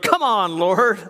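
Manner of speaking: throwing up his hands at God; exasperated plea. Retold or imitated. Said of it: "he prayed to the Lord".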